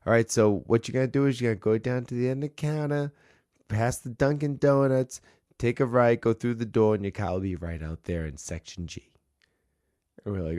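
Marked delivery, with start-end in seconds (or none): 0.1-9.1 quick, lightly put-on Boston accent. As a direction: rattle off the directions like a Boston clerk. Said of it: "get back into the accent".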